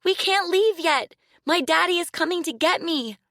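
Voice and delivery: high, loud, desperate pleading; screaming. Acted. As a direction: keep screaming, begging in panic. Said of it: "she screamed".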